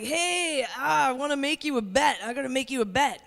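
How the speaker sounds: lightly voiced stoned teenager; stoned kid. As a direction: imitate a really high kid, loosely. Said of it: "He's really high, and he's hilarious".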